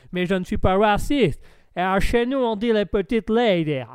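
loud caricature American sliding toward North African; slipping American accent. Acted. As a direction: overplay a muddled American accent. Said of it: "Un petit accent marocain, là, qui vient de sortir". (accent américain qui dérape vers le maghrébin)

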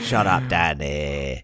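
playing a grumpy cinema-goer; snapping at a noisy neighbour; mock annoyed.

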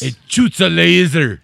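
loud, high, drawn-out mock boast; hammed-up Kano routine. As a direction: belt it out slowly in character. Joins the Kano impression.

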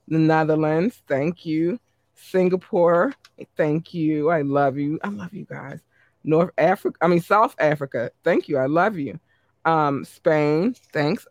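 ordinary talk, nothing marked

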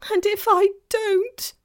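high, loud, tearful wail; beginning to cry. A narrator's reading. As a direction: wail as tears start to come. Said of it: "beginning to cry again".

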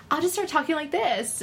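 high, bright service voice; customer service voice. Shown as a demonstration. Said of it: "my customer service voice".

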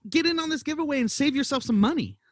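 ordinary talk, nothing marked

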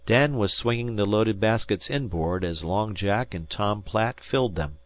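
ordinary delivery, nothing marked

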